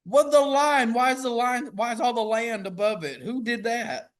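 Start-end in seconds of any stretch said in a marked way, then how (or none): 0.0-2.2 much higher, louder sputtering disbelief; outraged disbelief. Real, not acted. Friend confused by the equator, sputtering disbelief. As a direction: splutter at the line's position.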